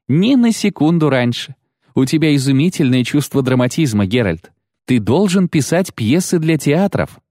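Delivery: high, theatrical enthusiasm; excited gushing. A narrator's reading. Dandelion praising Geralt's timing, excited gushing. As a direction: exclaim with a poet's flourish. Dandelion gushes over dramatic rescue.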